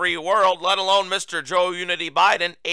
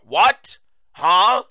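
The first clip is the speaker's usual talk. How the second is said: incredulous; 3 semitones higher, 7 dB louder than usual. Acted. Host reacting to the president's words, loud incredulous questions. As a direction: blurt it out in baffled disbelief.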